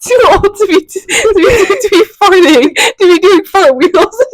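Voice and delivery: high words dissolving into tearful laughing; helpless laughter. Real, not acted. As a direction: keep restarting through real laughing fits. Laughing helplessly at own story.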